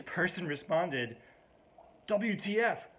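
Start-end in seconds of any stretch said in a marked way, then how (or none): 2.1-2.9 high-pitched outraged retort; mock outrage. Person replying to a wrong number text, mock outrage. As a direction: fire it off, higher and indignant. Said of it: "no need to be outraged".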